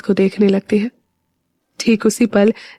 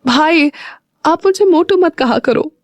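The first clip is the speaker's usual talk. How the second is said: higher, petulant little-sister complaint; annoyed whine; 7 semitones higher than usual. A narrator's reading. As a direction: pout it at your brother. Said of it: "Meera चिढ़कर बोली". (चिढ़कर, ऊँची आवाज़ में शिकायत)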